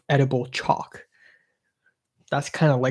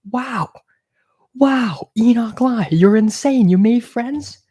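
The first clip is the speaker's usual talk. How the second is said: high, louder, mock-amazed self-ribbing; mock astonishment; 9 semitones higher, 6 dB louder, slower than usual. Teasing himself about making friends, mock astonished. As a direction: gasp at yourself like a stunned fan.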